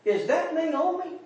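high, soft, slow wondering question; disbelieving question. Acted. Townsfolk looking at the returned Naomi, hushed disbelieving question. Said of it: "They asked that question".

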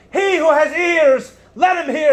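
high, loud, slow proclamation. Retold or imitated. Preacher voicing Jesus calling to the crowd, loud and slow. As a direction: call it out to the whole crowd. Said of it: "he, uh, raises his voice".

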